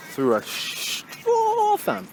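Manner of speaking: high squeak, drawn right out on "straw"; squeaky emphasis. Pretend. Stressing a point to viewers in a squeaky voice. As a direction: squeeze "Through a straw" up into a long high squeak. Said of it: "Can't even stress that enough".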